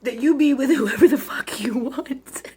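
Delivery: slow, choked whisper through tears; tearful whisper. Real, not acted. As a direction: push the words out while crying. Tearful emphatic declaration.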